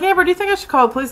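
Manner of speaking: rattled off very fast and high; rushed question. Retold or imitated. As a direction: blurt it all in one breath. Asking coworker urgently.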